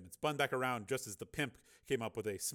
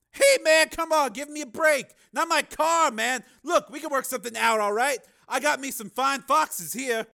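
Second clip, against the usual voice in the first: very high, loud wheedling street voice; pimp; 14 dB louder than usual. Acted. Pimp bargaining with the gunman for his car, pleading loudly. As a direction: plead and bargain, pitched far up.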